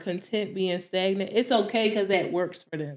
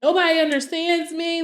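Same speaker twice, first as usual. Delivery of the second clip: high, hurt lament; retold crying complaint; 7 semitones higher than usual. Daughter retelling how she cried to dad, high-pitched lament. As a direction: plead as if worn out, without real tears. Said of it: "Like, I'm just crying".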